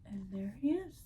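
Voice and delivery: quiet delighted reveal; hushed reveal. Real, not acted. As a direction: whisper the reveal. Delighted aside to listener.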